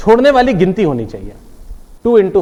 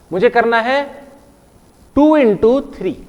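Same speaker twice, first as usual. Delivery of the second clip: usual speaking voice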